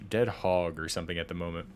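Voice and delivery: ordinary delivery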